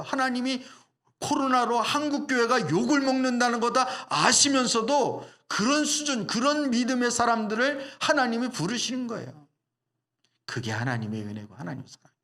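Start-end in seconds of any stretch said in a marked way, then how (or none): 0.0-9.5 higher, louder preacher's climax; fervent preaching. Acted. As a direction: build it like a pulpit peak.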